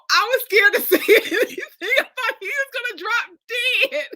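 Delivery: high, laughing through the words; laughing husband. Retold or imitated. Husband on the phone to his wife at work, laughing. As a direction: laugh it out, barely able to say it. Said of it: "was like".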